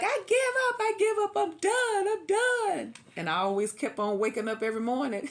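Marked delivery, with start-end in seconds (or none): 0.0-2.9 squeaky high defeated whine; high mock wail of giving up; high whiny giving up. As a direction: whine it like a kid quitting. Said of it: "And I'd be like".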